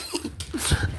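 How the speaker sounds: very high, thin giggle-squeal; tiny high-pitched laugh. Real, not acted. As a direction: squeal with hyper glee. Wants to be hyper happy.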